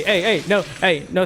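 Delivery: ordinary talk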